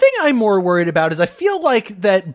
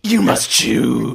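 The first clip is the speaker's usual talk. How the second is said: slow, lower-pitched mock-dramatic command; solemn mock pronouncement; 6 semitones lower, much slower than usual. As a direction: draw it out low, like a decree. Announcing a choice in character.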